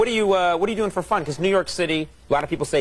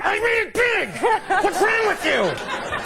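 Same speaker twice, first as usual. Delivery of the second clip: much higher, jokey outrage; hammed-up star tantrum; mock indignant yell; slower than usual. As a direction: pretend to scream at her in mock fury. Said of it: "I'd have been screaming at the woman".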